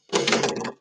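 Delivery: quick, voiceless gasp; surprised gasp. Real, not acted. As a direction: suck in air in surprise. Surprised by result of blowing.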